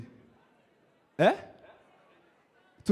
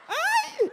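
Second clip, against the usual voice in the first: very high "aye"; teasing shriek. Pretend. Comedian catches a man enjoying the word, high teasing shriek. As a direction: squeal it in mock shock.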